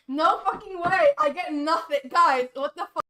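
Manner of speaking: loud exasperated outburst; indignant disbelief. Real, not acted. Streamer gets nothing, loudly exasperated. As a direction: protest loudly to the viewers. Complains of a bad result.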